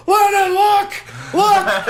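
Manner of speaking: very high, loud, drawn-out mock yell; thrilled dad. Dad with his hands in the air on the coaster, thrilled yelling. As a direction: imitate dad whooping on the ride.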